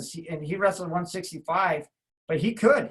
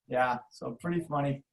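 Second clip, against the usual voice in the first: usual speaking voice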